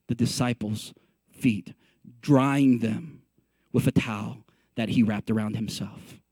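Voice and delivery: low, subdued storytelling; hushed, measured Bible narration; solemn scripture reading. A narrator's reading. Reads scripture in hushed register.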